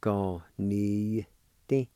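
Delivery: Ga-ni-ti slowly, each syllable stretched; slow pronunciation demo. Shown as a demonstration. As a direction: stretch out Ga-ni-ti evenly. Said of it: "like this".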